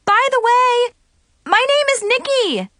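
high, loud sudden blurt. A narrator's reading. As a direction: blurt it out in a rush. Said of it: "I blurted out".

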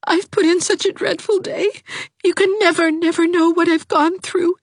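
put-on weepy young girl; tearful Dovey. A narrator's reading. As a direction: wail her misery.

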